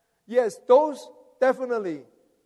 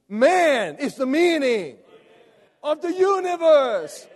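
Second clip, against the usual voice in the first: emphatic proclamation; 7 semitones higher, 6 dB louder than usual. Real, not acted. Preacher driving the title home to congregation, emphatic shout. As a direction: proclaim it, building to a shout on the last phrase.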